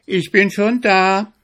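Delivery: high, loud triumphant call. Acted. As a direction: call it to the arriving hare. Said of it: "rief dem Zwenigel seine Frau". (triumphierender Ruf, hoch und laut)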